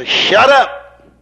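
abrupt emphatic command, voice lifted; sharp rebuke. Acted. Preacher cutting off the old devil's talk, sharp rebuke. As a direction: cut them off with a forceful order.

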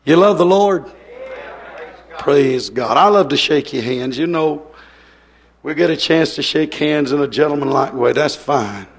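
ordinary talk, nothing marked

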